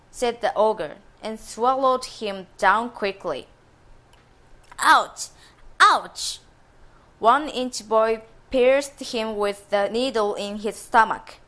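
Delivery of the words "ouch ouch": pained yelp. Acted. Ogre pierced inside.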